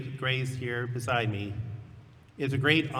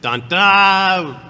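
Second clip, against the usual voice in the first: mock drumroll; 6 semitones higher, 14 dB louder than usual.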